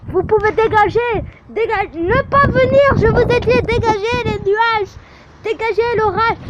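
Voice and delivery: loud, high, bossy shout at the clouds; shouting orders. Real, not acted. A girl shouting at the storm clouds to go away, loud and bossy. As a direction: yell at the storm to clear off.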